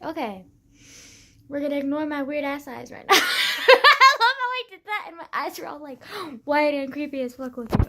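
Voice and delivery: normal talking voice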